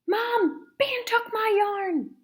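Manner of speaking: high, slow tattling cry; tattling whisper-shout. Acted. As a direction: tattle to Mom, high and urgent. Sister tattles to mom.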